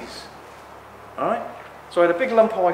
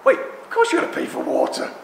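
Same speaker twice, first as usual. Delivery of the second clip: mocking the obvious; 3 dB louder than usual. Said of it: "For most people, it's like".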